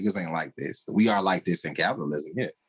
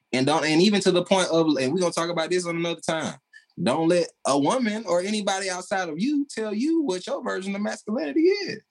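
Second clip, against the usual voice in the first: heated rant; 12 semitones higher than usual. Real, not acted. Podcast host to men listening, rising fired-up rant. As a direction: pitch climbing high, fired-up preaching.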